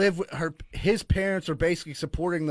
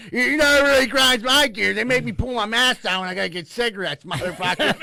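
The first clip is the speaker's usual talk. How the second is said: loud, higher mocking local guy; Mo Gross impression; 4 semitones higher, 5 dB louder than usual. Mimicking the guy who harasses him at Kogo's, loud and mocking. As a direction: ham up a gruff regular at the shop. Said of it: "I gotta hear Mo Gross ask me every time".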